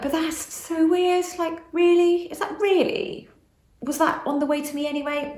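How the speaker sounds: retold disbelief, pitched high; high-pitched, mildly incredulous questioning; puzzled disbelief. Voicing her past puzzled disbelief.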